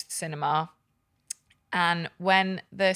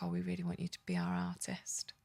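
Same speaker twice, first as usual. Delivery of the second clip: whispered quote; 11 dB quieter than usual. Retold or imitated. Quoting the call in whisper.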